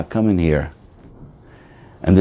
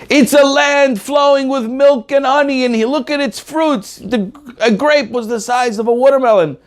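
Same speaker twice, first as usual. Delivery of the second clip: excited report; 13 semitones higher than usual. Retold or imitated. Spies to the people, high excited gushing. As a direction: sell the land with wide eyes.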